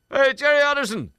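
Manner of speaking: high, loud cheeky yell; loud cheeky mock yell of the name; boy's shout. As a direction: shout it then hide. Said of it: "The boy shouts".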